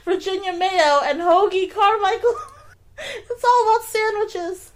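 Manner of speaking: talking through giggles; barely getting words out; laughing. Real, not acted. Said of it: "I just can't stop laughing".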